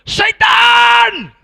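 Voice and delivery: huge, high, drawn-out yell; shouting. Retold or imitated. Preacher re-enacts yelling after the fleeing robbers, full shout. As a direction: bellow it after someone running away. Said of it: "I shouted".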